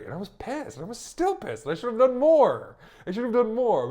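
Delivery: building to a high, loud exasperated outburst; worked-up indignation. Retold or imitated. Venting remembered anger at him.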